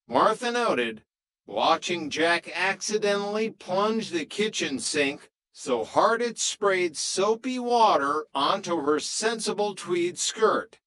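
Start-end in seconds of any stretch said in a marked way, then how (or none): none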